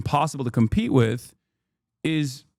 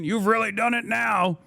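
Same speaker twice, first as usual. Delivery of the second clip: mocking haters; 7 semitones higher than usual. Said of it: "You know what I mean".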